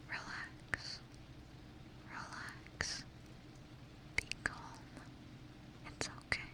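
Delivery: very soft, far-off whispered relax; ASMR trigger words, whispered very softly; close-up trigger words. Pretend. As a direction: breathe each relax right into the mic. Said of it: "Some close-up trigger words?".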